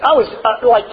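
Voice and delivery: usual speaking voice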